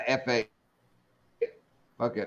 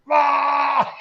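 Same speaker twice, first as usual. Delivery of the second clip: sudden high mock scream, louder; imitated scream; 10 semitones higher, 5 dB louder than usual. Imitating a bus driver screaming, a high mock scream. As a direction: belt out a short fake scream. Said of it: "screams it all the time".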